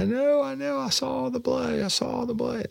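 very high thin wail, imitating a crying daughter; imitated crying. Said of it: "then she starts crying".

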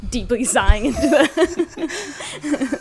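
words breaking into laughter; laughing. Real, not acted. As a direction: giggle through the words slowly.

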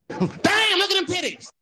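very high, loud holler; crude translation. Acted. Host translates his polite greeting, high hollered leer. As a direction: blurt it out in a squealing shout. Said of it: "What does that translate into?".

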